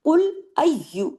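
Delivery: slow recited قل ایو with doubled یا; Quran recitation demo. Shown as a demonstration. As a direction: stretch قل ایو slowly, tajweed style. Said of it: "ایو، یا پہ تشدید ہے نا".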